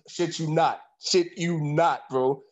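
normal talking voice

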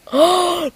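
sharp, high real gasp; horrified gasp. Real, not acted. Speaker realising she let his name slip, horrified gasp. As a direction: gasp at your own slip.

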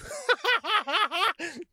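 high, wheezy giggle; high-pitched laughter. Real, not acted. Comic cracking up at his own impression, high laugh.

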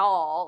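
Y'all with the vowel drawn out; Southern drawl. Shown as a demonstration. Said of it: "I drawl it out more".

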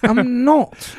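high-pitched mock British accent; proper English. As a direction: exaggerate a prim British accent. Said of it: "Then they learn the proper English".

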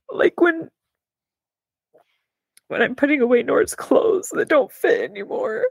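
holding back tears over outgrown clothes; tearful. Real, not acted. Mom tearing up over baby clothes, choked voice. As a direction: voice rising high as tears come. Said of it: "like, gonna cry. Oh, gosh".

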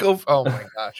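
ordinary talk, nothing marked

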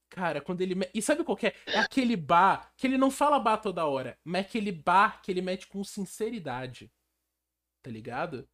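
ordinary delivery

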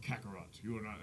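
deep, hushed villain imitation; very low talking. Imitating Dragon Ball Z characters miles apart, very low voice. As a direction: drop it to a low mutter. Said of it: "they're talking dumb low".

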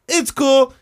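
high, loud reassurance; mock holler up to a balcony; imitated calling back. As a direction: call it out easily, pitched high.

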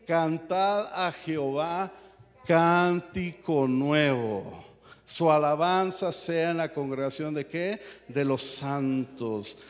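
slow, loud, drawn-out psalm reading; solemn scripture reading. Acted. Pastor reading Psalm 149 to the church, solemn proclamation. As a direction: proclaim each word of the psalm slowly.

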